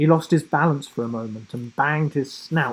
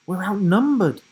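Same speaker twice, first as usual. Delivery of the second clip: alarmed; 8 semitones higher, 6 dB louder than usual. A narrator's reading. Girl alarmed at enemies.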